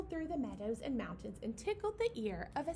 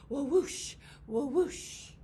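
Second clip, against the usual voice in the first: loud whoosh; 6 dB louder, slower than usual. Acted. Eagle wing sounds.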